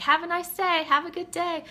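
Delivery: high, singsong well-wishing; stock pleasantry. Retold or imitated. What we all say to each other, mock cheery. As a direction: mimic a polite everyday send-off.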